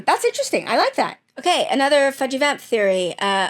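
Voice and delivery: ordinary delivery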